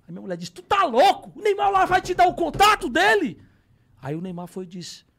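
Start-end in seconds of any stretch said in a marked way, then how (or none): 0.5-3.4 loud, high-pitched incredulous yell; mocking imitation of a scandalized wife; wife's outburst. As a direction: shriek it like an outraged wife. Wife's disbelieving outburst, acted out.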